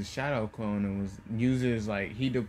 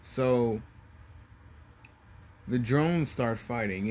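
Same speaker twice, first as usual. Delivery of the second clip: plain reading voice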